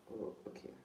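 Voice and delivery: ordinary talk, nothing marked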